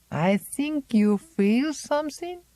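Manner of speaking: high, slow probing rise on "feel"; probing question. Acted. Old Zélie questioning Miranda, high rising and knowing.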